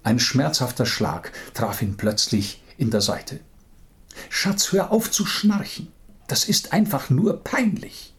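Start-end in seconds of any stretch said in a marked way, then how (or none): 4.3-8.1 higher, irritated wife voice; annoyed wife. Acted. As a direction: scold him for snoring. Wife nudges snoring husband. (genervte Ehefrau)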